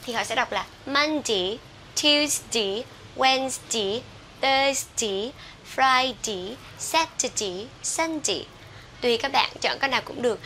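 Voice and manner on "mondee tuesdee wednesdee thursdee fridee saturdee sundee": each day from "Monday" to "Sunday" ending in "dee". Shown as a demonstration. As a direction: turn day into "dee", as in "Mondee". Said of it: "họ đọc thành chữ D". (đọc "Monday" đến "Sunday" với đuôi "đi")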